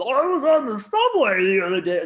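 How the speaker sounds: high, loud mocking whine; mock a boring comic. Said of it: "These people getting up".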